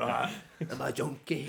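slow, lightly put-on junkie drawl; jakey voice. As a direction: mumble it like a wasted junkie. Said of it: "jakey voice".

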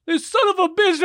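high, laughing indignant cry; laughing mock outrage. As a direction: crack up voicing the offended fan. Imagined angry fan post.

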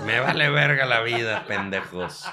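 loud, mock-furious swearing rant; hammed-up angry outburst at the viewers; a fake tantrum played for laughs. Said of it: "ya mostrando el verdadero".

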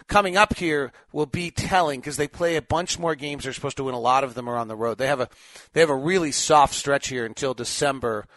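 normal talking voice

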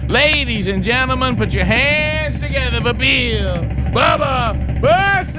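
building boxing-ring announcer intro; grand entrance announcement. Acted. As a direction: hype him up like a ring announcer. Radio host introduces co-host.

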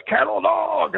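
high-pitched yelled call; trainer's call. Retold or imitated. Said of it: "our trainer runs on and goes".

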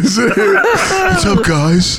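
high, laughing bro greeting. Retold or imitated. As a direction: mimic a cheery gym bro.

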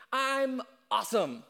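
smug self-praise. Pretend.

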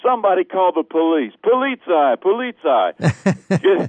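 calling for police. Retold or imitated. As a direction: call out for the police, drawing out polizei. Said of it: "calling for police on train".

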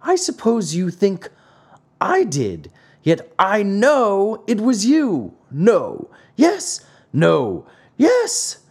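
high, insistent storybook squabble; heated bickering. A narrator's reading. Two old men contradict each other, acted heated bickering. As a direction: argue back and forth, pitch climbing. Acted quarrel, growing angrier.

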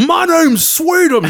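high, slow, goofy monster voice; Sweetums. Acted. Big monster introduces himself to the chef, goofy high voice. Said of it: "He goes".

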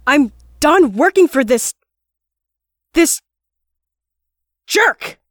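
building to a loud, high shout on jerk; angry outburst. Acted. The guard quits working for the lord, shouting him down. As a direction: spit out jerk at your old boss.

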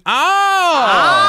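put-on high, loud old-timey "Oh!"; old-timey exclamation. Radio host showing off an impression, old-timey exclamation. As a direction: cry it out like an old-timey performer. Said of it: "Number two is the old-timey".

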